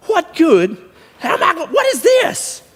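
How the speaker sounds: high, exasperated comic shouting; throwing a fit. Retold or imitated. Cowboy griping over tie ropes in his pickup, throwing a fit. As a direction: shout in hammed-up disbelief. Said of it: "I quit throwing a fit".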